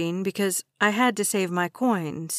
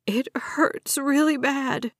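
higher, slow, tearful; sobbing; 5 semitones higher, slower than usual. A narrator's reading. Girl in pain pleads on the phone, slow tearful sob. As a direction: let the sob break through. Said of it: "I couldn't hide my sob".

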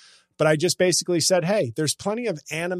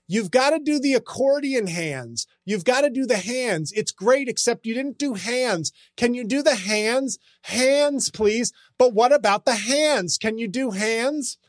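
high, nagging commenter voice demanding hands; mock a pestering fan begging for hands; whiny commenter; 7 semitones higher than usual. Comic mocking a fan's comments, high and nagging. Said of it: "the most common refrain after people say".

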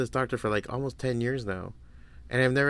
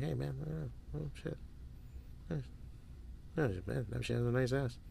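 much softer, distracted aside; distracted hush; 13 dB quieter than usual. Noticing a woman mid-meeting, hushed and distracted. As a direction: drop the voice as your eye wanders.

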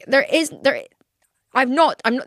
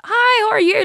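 mock chirpy greeting; 12 semitones higher, 5 dB louder than usual. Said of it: "if I'd pulled over and been like".

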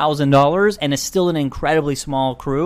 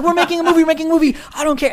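frantic explaining; 12 semitones higher, much faster than usual. Retold or imitated. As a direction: plead it fast to armed cops. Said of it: "I'm like".

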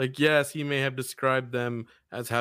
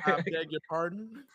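mock Southern belle; 4 semitones higher, 4 dB quieter than usual. Southern belle bit continued.

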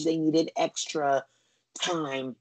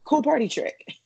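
mock impressed; 10 semitones higher than usual. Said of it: "And you're like".

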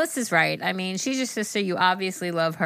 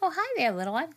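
high cooing pet voice; 10 semitones higher, faster than usual. Real, not acted. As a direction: coo at the kitten. Greeting the cat.

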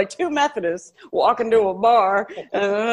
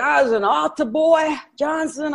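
put-on lightly done Brooklyn accent, pitched up; a hint of Martin's Brooklyn accent, pitched up; 6 semitones higher than usual. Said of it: "he has this accent".

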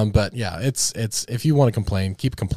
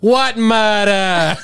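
put-on loud, drawn-out New York street accent; 8 semitones higher, 3 dB louder, much slower than usual. Imitating guys on the street, exaggerated slow, high New York accent. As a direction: stretch it out like a hollering New Yorker. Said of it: "Like those guys on the street".

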